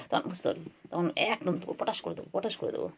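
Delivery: quick, hushed playful threat; whispered mock threat. Threatening the cat in fun, quick whisper. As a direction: whisper the threat fast, teasing.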